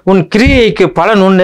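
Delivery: pitched high and loud, announcing the promise; ringing proclamation. Acted. Preacher to his congregation, proclaiming the verse high. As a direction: lift the verse up high and proud.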